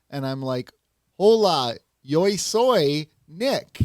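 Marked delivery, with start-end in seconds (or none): 1.2-3.8 bad Spanish. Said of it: "speaking very poor Spanish".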